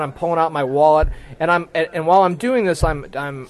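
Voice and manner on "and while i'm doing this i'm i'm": ordinary delivery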